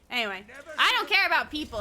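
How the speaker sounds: usual speaking voice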